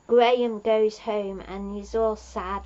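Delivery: ordinary delivery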